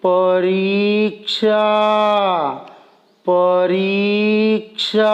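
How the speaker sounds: each sound stretched very slowly; slow motion. Shown as a demonstration. Teacher slowing a word so pupils hear the parts. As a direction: drag the word out syllable by syllable. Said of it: "इसे भी हम slow motion में बोलेंगे".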